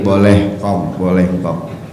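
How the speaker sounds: a hint of a low fatherly voice; gentle answer. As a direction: reassure the old man fondly. Prophet answering old man kindly.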